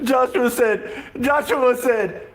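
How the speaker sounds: high, slow, through leftover giggles; barely getting the words out; laughing. Real, not acted. Retelling Joshua's words, still giggling.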